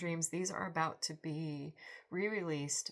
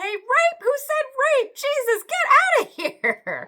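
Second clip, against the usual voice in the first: much higher, louder shout breaking into laughter; outraged yell; 19 dB louder than usual. Retold or imitated. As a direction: scream it out, laughing at the end. Anne's outraged reply to stranger.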